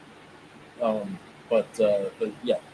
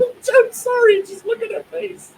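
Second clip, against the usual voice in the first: high, helpless giggling through the words; can barely get it out for laughing; 9 dB louder than usual. Real, not acted. Watching the paused reaction clip, he bursts out laughing.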